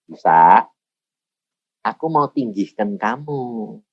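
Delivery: a hint of a solemn divine voice; voice of God. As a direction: suara Tuhan yang pelan dan rendah. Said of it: "Ngomongnya gini".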